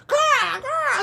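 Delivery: shrill crow caws; mock bird cawing; high caw. Birds cawing.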